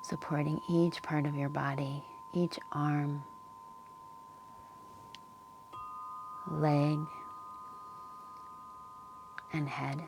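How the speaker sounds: calm Yoga Nidra guidance with long pauses; unhurried, leave space between phrases to settle them in; guided meditation. Real, not acted. Yoga teacher settling listeners into the earth's support, calm guided meditation.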